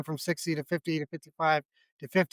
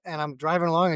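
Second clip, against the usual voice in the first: ordinary talk, nothing marked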